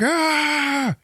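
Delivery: long high-pitched imitated scream; hammed-up agonized "aah"; mock scream of pain. Retelling a thumb jammed into an eye, mock pain scream.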